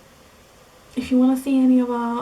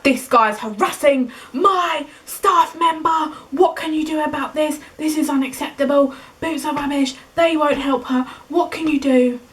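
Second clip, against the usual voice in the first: higher, louder indignant complaint; fuming protective manager; 6 semitones higher, 4 dB louder than usual. Retold or imitated. Manager to security guard about the harasser, fuming and louder. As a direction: demand action like a furious protective mother. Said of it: "she was fuming".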